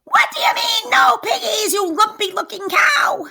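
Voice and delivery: high, loud screeching witch voice. Acted. Witch screeching at the cow who has no pigs. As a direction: shriek it at the cow in outrage. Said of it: "screeched Gritch".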